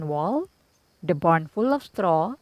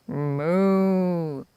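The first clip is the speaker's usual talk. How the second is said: cow moo; 2 semitones lower, 5 dB quieter than usual. A narrator's reading. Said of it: "the cow said".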